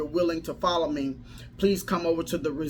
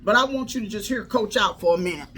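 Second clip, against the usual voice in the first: rising, louder preacher cadence; preaching; 4 semitones higher, 3 dB louder than usual. Acted. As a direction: preach it with building fire. Preaching build-up to audience.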